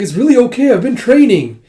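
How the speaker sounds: insisting. Acted. Yona insists she's fine.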